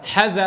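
حز، with the vowel cut short; madd removed. Shown as a demonstration. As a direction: say حز، with a short a, no lengthened alif. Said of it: "لو شلنا المد الطبيعي".

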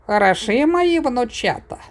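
put-on high-pitched old granny voice; high, fond old-woman exclamation; doting granny. A narrator's reading. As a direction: coo proudly over the grandchildren. Granny admires her grandpups.

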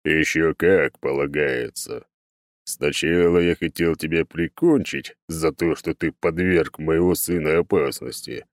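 slow, weighty god voice; stern oak god. Acted. God reproaches hero over his son, stern and slow. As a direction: spell it out slowly and sternly.

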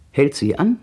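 normal talking voice

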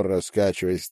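plain reading voice

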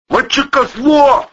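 high, drawn-out attack cry; battle shout. A narrator's reading. Thug charging with brass knuckles, shouting. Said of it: "крикнул Протасов".

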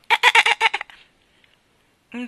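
very high squeaky dolphin clicks; high dolphin chatter in reply. Acted. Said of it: "La sua risposta fu un altro".

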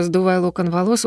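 plain reading voice

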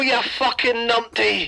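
ordinary delivery

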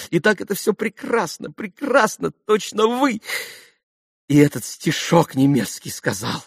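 rhapsodise, carried away with admiration; gushing society mother. A narrator's reading. Khokhlakova praises Ivan's youthful exit, gushing and high.

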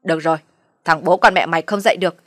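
loud shrieking tirade, building to the end; screaming rage. A narrator's reading. Mother-in-law screams at the daughter-in-law, building rage. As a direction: scream her down, beating her chest. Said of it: "Bà vỗ ngực đen đét mà lại gào lên".

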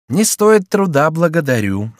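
high, slow, emphatic refusal; piqued, drawn-out politeness; curt refusal. Acted. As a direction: refuse pointedly, dropping on the thanks. Thwarted Chicot refuses an escort.

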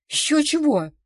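loud, high-pitched indignant refusal. A narrator's reading. Injured man refusing the hospital, indignant. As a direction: burst out in outrage. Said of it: "возмутился Владик".

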